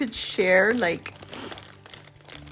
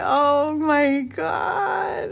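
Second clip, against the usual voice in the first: high, slow, loud delighted cry; gushing high-pitched delight; overjoyed squeal; 11 semitones higher, 3 dB louder, much slower than usual. Real, not acted. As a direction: squeal with joy at the gift.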